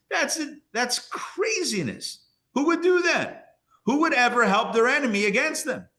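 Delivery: who on earth would do that, voice flying up; mock outrage at the very idea; incredulous outburst. Voicing an indignant objector.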